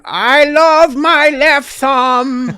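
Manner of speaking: high, slow, goofy drawl; mock Sandler voice. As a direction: ham up a dopey celebrity voice. Sandler impression, mocking.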